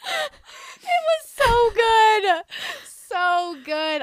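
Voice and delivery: high squealing laugh through the words; overjoyed laughing squeal; laughing gush. Real, not acted. Gushing about favourite scene.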